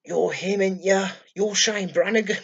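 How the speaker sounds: high-pitched, amused mimicry of recognition; imitated excited realisation with a laugh. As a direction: act out suddenly recognising someone famous. Acting out the man recognising him.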